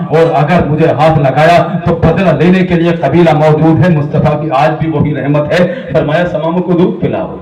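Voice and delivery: ordinary delivery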